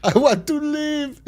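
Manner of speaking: very high, slow, stretched-out voice on I want to live; mock high wail. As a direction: camp melodramatic whimper. Echoes friend's silly voice.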